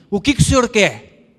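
loud, high, half angry half scared; a sick man startled by a stranger at the door; gruff startled challenge. Retold or imitated. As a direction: bark it like a startled big man. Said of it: "meio que bravo, e diz assim, meio assustado".

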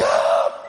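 re-enacted war cry; storyteller's mock scream; retold yell. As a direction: let out a lunging yell. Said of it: "e eu gritei".

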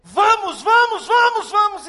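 high, loud yell to flee; alarmed robber's shout. Retold or imitated. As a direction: shout to your partner to get away. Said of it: "o rapaz gritou assim".

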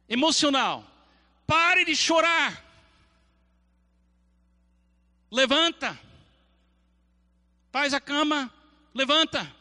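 stern voice of God. Acted. God ordering the emotional person to stop crying and get up. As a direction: command the emotional one to get up. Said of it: "A voz de Deus na sua vida".

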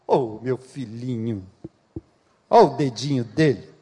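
a hint of a parent cooing over a little one; doting parent. Parent who finds the toddler's mischief cute, lightly voiced doting coo.

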